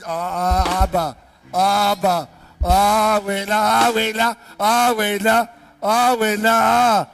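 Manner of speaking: loud, drawn-out acted moan; mock wailing protest. Preacher acting believers whose face changes when tested, mock wail. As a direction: wail it slowly in exaggerated complaint. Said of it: "our face will change".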